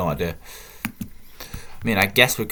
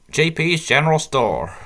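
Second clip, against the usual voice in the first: higher, badly faked American accent; put-on American accent, pitched up; terrible American accent; 6 semitones higher, 4 dB louder than usual. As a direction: read the shop sign as a hammy American. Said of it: "terrible American accent now".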